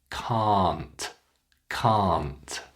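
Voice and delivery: "Can't" said cahnt, drawn out; long ah. Shown as a demonstration. Teacher drills a tricky word, long "Can't". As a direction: stretch "Can't" with an open ah, falling. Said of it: "Make it long".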